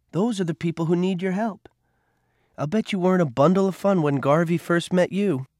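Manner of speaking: put-on a caring nurse comforting a boy; Rosie, tender. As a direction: soothe him with kind advice. Rosie counsels Cole.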